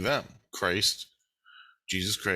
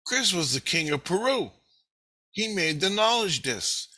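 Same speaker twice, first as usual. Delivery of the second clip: higher-pitched, naive announcement; imitated innocence; 7 semitones higher than usual. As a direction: voice an innocent self telling the family. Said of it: "My innocence speaking to the family said".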